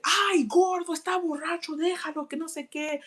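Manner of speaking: high pleading mom voice; imitating mom. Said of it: "And she's like".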